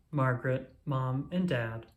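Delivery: usual speaking voice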